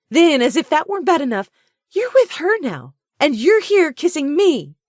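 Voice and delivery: very high, loud, fast tirade; raging. A narrator's reading. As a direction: let the fury boil over. Her rage escalates.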